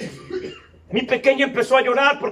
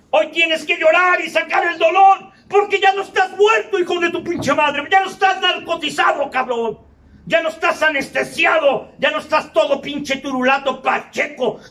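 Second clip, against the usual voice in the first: raised, shouting sermon voice; fierce, shouted preaching; preaching at full force; 7 semitones higher, 3 dB louder than usual. Acted. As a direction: hammer it at them like a preacher.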